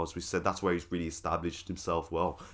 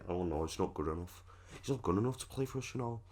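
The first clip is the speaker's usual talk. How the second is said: light Scouse impression, faster in places; a hint of Liverpool accent voicing Gerrard; 4 semitones higher, 7 dB quieter than usual. Gerrard turning down the signing, in a light Liverpool accent. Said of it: "doing the Liverpool accent there".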